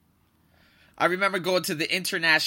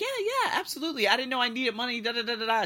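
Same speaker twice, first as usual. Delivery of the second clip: much higher, overeager yes-yes; eager agreement; 8 semitones higher, faster than usual. Retold or imitated. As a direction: jump at the offer, all nerves. Eager reply to helpful girl.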